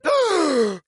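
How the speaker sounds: a high, sharp gasp of shock; mock kid gasp. Kid watching mom eat the candy, a high gasp. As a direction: gasp like a horrified little kid.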